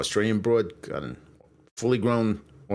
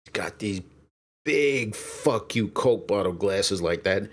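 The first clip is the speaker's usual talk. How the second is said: usual speaking voice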